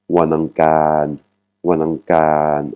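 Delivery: Wanangkan slowly, with a drawn-out "kaan"; long final vowel. Shown as a demonstration. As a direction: stretch the last vowel of Wanangkan. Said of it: "la voyelle longue sur la dernière syllabe". (Wanangkan, voyelle longue sur la dernière syllabe)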